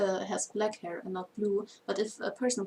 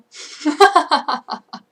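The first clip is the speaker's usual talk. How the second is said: high laugh bursting out; loud burst of laughter; 7 semitones higher, 15 dB louder than usual. Real, not acted. Laughs at being scared.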